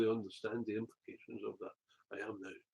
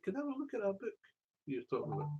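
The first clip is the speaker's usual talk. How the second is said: woman's voice; 10 semitones higher than usual. Retold or imitated. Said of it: "and she said".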